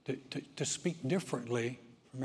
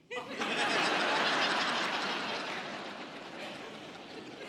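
high breathless wheeze of laughter; laughing silently at his own punchline; wheezing laugh; 9 semitones higher than usual. Real, not acted. Preacher cracks up at the parrot joke, wheezing laugh.

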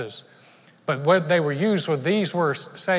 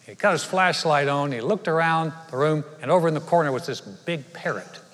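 usual speaking voice

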